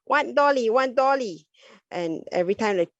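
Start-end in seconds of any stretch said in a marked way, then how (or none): 0.1-1.5 put-on high, childlike voice; a toddler reaching for a doll, high and insistent; child asking. Toddler wanting a doll, high childlike voice. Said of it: "a child wants a doll".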